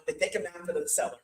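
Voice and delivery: usual speaking voice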